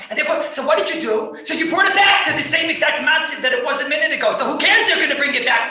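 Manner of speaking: excited shout. Real, not acted. Rabbi pressing his students, loud and gleeful. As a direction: pile on the argument with gleeful energy.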